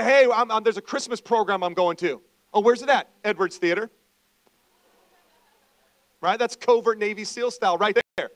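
loud, high, energetic preacher voice; full-force preaching. Acted. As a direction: fire up the congregation. Loud preaching about inviting people.

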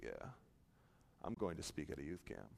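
very low, hushed humblebrag; imitating a quietly puffed-up young preacher; mock self-importance. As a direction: drop it low and quiet, playing big-time.

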